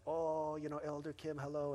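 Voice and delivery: a hint of bright locker-room greeters; friendly greeting. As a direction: gym regulars greeting Elder Kim, lightly voiced. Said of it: "people are like".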